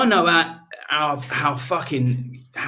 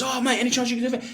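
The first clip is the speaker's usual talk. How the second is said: eager asking; 10 semitones higher, 4 dB quieter, faster than usual. Retold or imitated. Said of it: "he goes".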